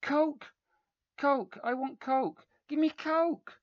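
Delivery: high, loud tantrum screams; bratty screaming for Coke. A narrator's reading. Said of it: "he screamed".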